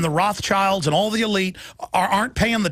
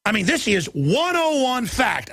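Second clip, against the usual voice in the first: usual speaking voice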